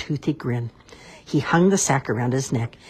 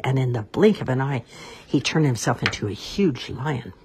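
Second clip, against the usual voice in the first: ordinary delivery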